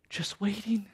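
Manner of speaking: whispered; hushed appeal. Real, not acted. Preacher appealing for people waiting, hushed. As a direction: drop to an earnest whisper.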